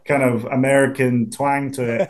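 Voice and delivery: ordinary delivery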